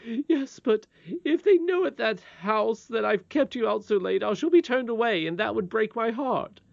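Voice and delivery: high, loud, worried lament; fretful nurse. Acted. Nurse fearing she will be dismissed, fretful.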